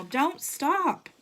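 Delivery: high urgent yell while running. A narrator's reading. Henry pulling him through the dark, urgent yell. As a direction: yell to keep him going. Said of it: "would yell".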